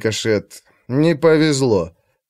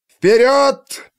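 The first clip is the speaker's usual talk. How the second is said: battle cry; 11 semitones higher, 3 dB louder than usual. A narrator's reading. Commander orders the advance.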